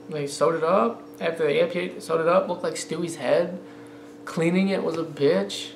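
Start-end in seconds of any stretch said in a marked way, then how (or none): none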